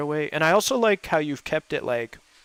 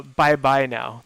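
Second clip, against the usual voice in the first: slow, louder drawn-out bye bye now; SNL catchphrase; 7 dB louder, much slower than usual. Retold or imitated. Recalling an SNL skit to friends, slow drawn catchphrase. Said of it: "The S N L skit".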